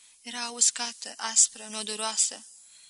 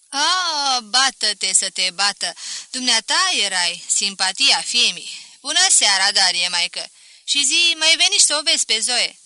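effusive mother-in-law; 11 dB louder than usual. Acted. Mother-in-law recognises her daughter's old sweetheart, loud effusive greeting. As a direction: hail an old acquaintance loudly.

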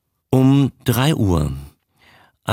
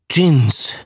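higher, lightly acted groan of horror; mild groan; 4 semitones higher, 3 dB louder than usual. A narrator's reading. Horror-struck diver names what he saw, lightly groaned. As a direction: groan the single word out, understated. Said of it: "stöhnte der Singalese".

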